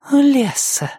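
high, eerie little voice; high-pitched newborn phantom; whispering ghost child. Acted. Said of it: "донёсся до меня шёпот".

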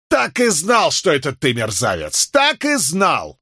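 high-pitched furious shout; angry outburst. Acted. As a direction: lash out in triumphant rage. Attacker shouts at victim.

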